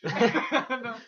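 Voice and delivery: usual speaking voice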